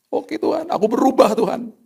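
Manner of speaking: high, humbled giving in; patient conceding to God, high and sheepish; sheepish surrender. Retold or imitated. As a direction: concede with a rueful smile.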